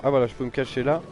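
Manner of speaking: usual speaking voice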